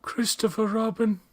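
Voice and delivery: high-voiced character impression; Winnie the Pooh. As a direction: pitch it way up as a storybook bear. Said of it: "Is that a good poo?".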